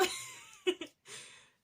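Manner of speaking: real squealing burst of laughter; high-pitched laugh. Real, not acted. Laughing at her own English accent, high squeal.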